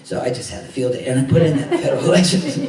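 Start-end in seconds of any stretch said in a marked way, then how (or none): 1.2-2.6 breaking into a laugh mid-phrase; quick, laughing as you get the words out; real chuckle bubbling through the words; amused laughing. Real, not acted. Amused at own cheeky addition.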